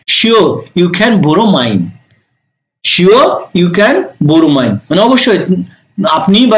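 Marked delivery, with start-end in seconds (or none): 0.1-4.7 slow, careful English reply; slow model sentence. Shown as a demonstration. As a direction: repeat it slowly for learners. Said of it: "দেখেন কি বলতেছে". (ধীরে ধীরে স্পষ্ট উচ্চারণ)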